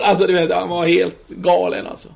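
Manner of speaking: normal talking voice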